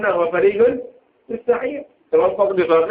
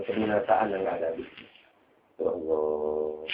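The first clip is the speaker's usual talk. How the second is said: mournful lament; 11 semitones lower, 7 dB quieter than usual. Acted. Sauban grieving over parting from the Prophet, lamenting softly. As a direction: let the voice sink in sorrow. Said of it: "dia pikir, dia nangis".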